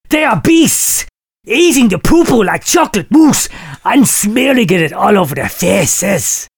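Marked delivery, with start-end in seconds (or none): none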